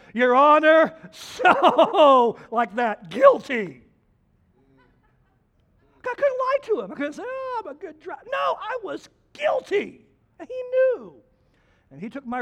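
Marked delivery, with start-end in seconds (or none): 0.1-3.8 loud, higher mock confession with a laugh. Preacher answering the judge, loud and laughing. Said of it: "I said to him, I said". 6.1-11.2 high earnest. Real, not acted. Preaching climax, much higher pitch.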